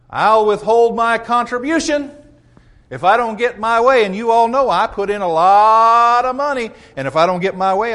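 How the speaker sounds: hammed-up donor threatening the church; mock threat. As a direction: throw your weight around over money. Acted member threatens withholding money.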